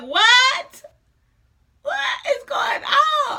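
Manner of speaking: very high, wailing mock panic; panicked disbelief. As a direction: squeal it out like a comic meltdown. Retold panic when epidural ran out.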